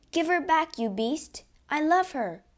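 high, loud, impassioned plea; angry demand. A narrator's reading. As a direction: demand it from your rival. Count confronts the phantom.

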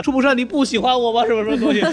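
put-on high coy girl's plea; lightly mocking a clingy girl begging for love; girly Shizuka voice. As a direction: play a sweet clingy schoolgirl. Shizuka pleading, voiced in retelling.

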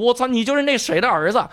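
high, startled cry; sudden realization. Retold or imitated. Writer recognizing the soldier's son, startled high cry. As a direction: burst out as it dawns on him.

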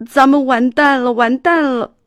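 high, loud frantic wail; panicked. Acted. Buster despairing to Arthur with no bus money, panicked. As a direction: stamp your feet in panic. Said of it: "巴斯特急得直跺脚".

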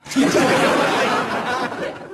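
giggling high and airy; high breathy laugh. Real, not acted. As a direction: burst into helpless giggles. Laughs at own joke.

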